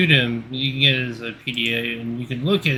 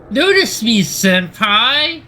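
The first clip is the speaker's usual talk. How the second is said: high, loud anime fangirl squeal; overacted anime dub; 9 semitones higher, 6 dB louder, slower than usual. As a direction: shriek it like an anime schoolgirl. Anime fan plea.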